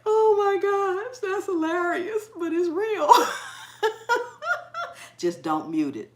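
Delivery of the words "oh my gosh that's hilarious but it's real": loud, high, drawn-out words breaking into laughter; helpless amusement. Real, not acted. Host cracking up at her own joke, laughing loudly. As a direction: exclaim it through real laughter, pitched up.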